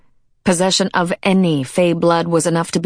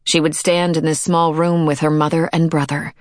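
ordinary delivery

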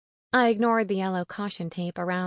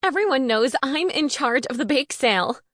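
high, loud territorial outrage; frantic, raised-pitch protest; near hysterics; 6 semitones higher, 6 dB louder than usual. A narrator's reading. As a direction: insist loudly, close to losing it. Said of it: "near hysterics".

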